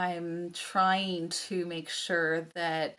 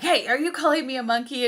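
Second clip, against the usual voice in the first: quick, raised, playful challenge; mock indignation; 6 semitones higher, 5 dB louder, much faster than usual. Cole teasing his son over the joke, mock indignation. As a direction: challenge him in fun, fast and loud.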